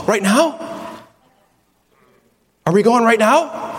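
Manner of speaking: excited asking. Pretend. Eager child asking to leave.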